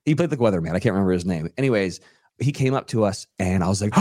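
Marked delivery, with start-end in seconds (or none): none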